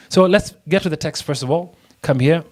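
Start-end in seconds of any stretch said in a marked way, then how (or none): none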